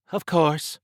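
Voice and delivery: high, loud amused echo; obvious amusement. A narrator's reading. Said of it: "Trish said with obvious amusement".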